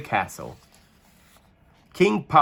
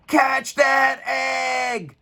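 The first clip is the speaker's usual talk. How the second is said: cried out; 14 semitones higher, much slower than usual. A narrator's reading. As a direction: yell after the rolling egg. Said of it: "cried the knights".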